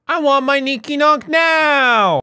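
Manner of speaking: very high, drawn-out demanding yell; mock tantrum. Demanding the ninky nonk in a mock tantrum. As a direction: wail it out like a spoilt child.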